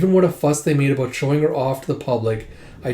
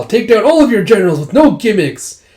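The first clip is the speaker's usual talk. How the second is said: raised, louder, stung by the insult; offended young warrior snapping back, higher and louder; insulted retort; 7 semitones higher, 8 dB louder than usual. Retold or imitated. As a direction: fire back a hot-headed boast. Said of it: "Gi just all insulted by that".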